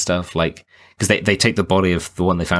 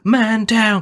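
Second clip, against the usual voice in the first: retold scream; 4 dB louder than usual. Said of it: "as soon as he screams".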